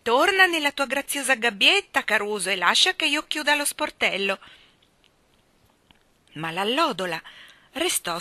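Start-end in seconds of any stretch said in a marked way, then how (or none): 0.0-4.4 loud, high bossy urging; insistent raised call; crying out. Acted. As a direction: order the bird back, voice raised. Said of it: "gridò la signorina Andrew".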